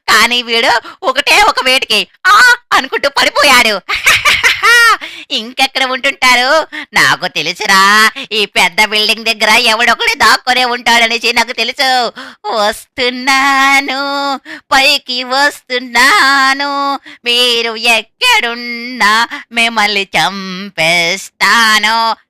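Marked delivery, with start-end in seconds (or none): 3.9-5.3 high villain cackle; evil laugh. Acted. Killer gloats over a kill. 12.5-22.2 drawn-out menacing sing-song; slow taunting. Acted. Killer taunting hidden players.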